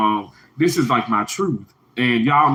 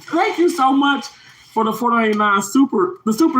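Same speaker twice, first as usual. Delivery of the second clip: real gushing thanks; 10 semitones higher than usual. Real, not acted. Thanking a viewer for the super sticker, gushing.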